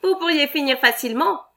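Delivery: mean boss sneering reply; nasty retort. Acted. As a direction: spit it back nastily. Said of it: "répliqua méchamment le patron".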